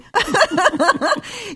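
high peal of laughter; giggly laugh. Real, not acted. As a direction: burst out laughing after flirty teasing. Laughs after teasing compliment.

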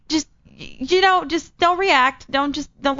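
high-pitched, halting persuasion; coaxing plea. Retold or imitated. Employee pleads with manager over costumes, coaxing. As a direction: act out talking someone down from a hasty no.

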